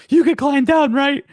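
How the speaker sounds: high sing-song mock coaxing; mock sweet question. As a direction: coax him toward the cliff edge. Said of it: "she's just like".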